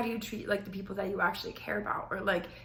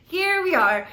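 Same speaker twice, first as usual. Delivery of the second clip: louder and higher "Here we are"; resigned here we are; 8 semitones higher, 10 dB louder, slower than usual. Retold or imitated. Narrator resigning herself to drinking, louder. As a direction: throw up hands, might as well.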